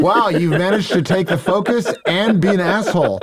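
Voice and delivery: high, sarcastic congratulation; comic retold scolding; mock reproach. Acted wedding reproach, comic.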